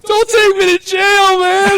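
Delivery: high, slow mock whimper; whining drunk. Drunk man begging not to be jailed, high mock whine. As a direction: plead like a blubbering drunk. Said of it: "And he's like".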